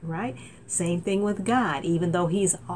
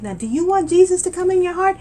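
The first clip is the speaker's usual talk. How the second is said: high, loud eager question; inviting a stranger to accept Jesus; eager invitation; 12 semitones higher, 7 dB louder, faster than usual. Retold or imitated. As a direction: ask with bright urgency. Asking bus rider.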